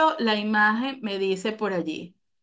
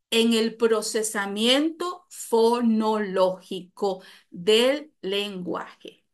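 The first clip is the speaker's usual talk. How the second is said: slow stressed phrase; 2 semitones lower, much slower than usual. Shown as a demonstration. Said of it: "recalca la definición".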